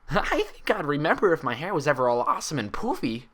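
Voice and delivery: high, bubbly pony voice, puzzled; Pinkie voice. Acted. Pinkie asking Twilight about her hair, high and bubbly. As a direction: bounce up high and curious.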